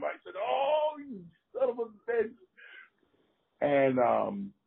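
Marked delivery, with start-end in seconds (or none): 0.4-2.3 high, strained mock outrage; pained outrage. Wrestler cursing after the blow lands, high and pained. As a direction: squeal the curse as if just hit. Retold reaction to being hit.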